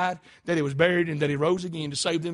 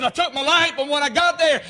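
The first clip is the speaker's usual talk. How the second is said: high, loud admission; impassioned confession; 6 semitones higher, 7 dB louder than usual. Acted. Watchman explaining his failure in court, impassioned confession. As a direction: cry out the confession.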